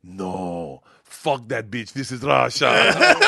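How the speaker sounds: put-on accented dismissal swelling to a loud, drawn-out finish; Russian cop. Cop to student close up, Russian-accented dismissal. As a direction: start contained, then stretch the last words loudly. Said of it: "he's like".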